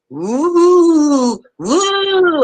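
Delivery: high hooting ghost woo; ghost moan. Retold or imitated. Caller imitated moaning like a ghost. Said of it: "sounding like a ghost".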